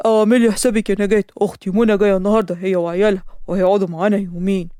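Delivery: lightly voiced father announcing guests; a hint of the father. Father telling his wife his sister is coming, lightly voiced.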